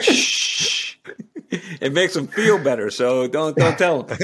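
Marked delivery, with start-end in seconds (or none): 0.0-0.9 playful shush. Acted. Telling a secret to listeners.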